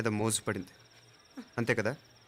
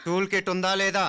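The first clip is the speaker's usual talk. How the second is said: higher, insistent interrogation; firm, raised-pitch questioning; stern pressing demand; 6 semitones higher, 3 dB louder than usual. Acted. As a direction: press hard for a yes or no. Repeats the question, pressing the suspect.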